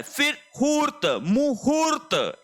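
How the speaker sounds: मुहूर्त high and slow with a long stretched हू; teacher models मुहूर्त slowly; slow long vowel. Shown as a demonstration. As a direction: draw out the हू in मुहूर्त. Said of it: "मु पहले लघु मात्रा है".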